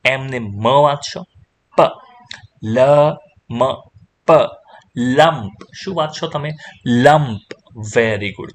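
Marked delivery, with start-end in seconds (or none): 1.8-4.3 "પ, લ, મ, પ" as isolated letter sounds; sounded out. Shown as a demonstration. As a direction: leave a gap after each of "પ, લ, મ, પ". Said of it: "તમે વાંચશો".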